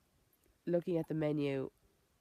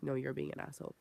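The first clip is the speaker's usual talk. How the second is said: said under the breath; 6 dB quieter than usual. Retold or imitated. Wife to husband in a restaurant, quiet telling-off. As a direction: keep the insult quiet and matter-of-fact. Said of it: "I said it like that".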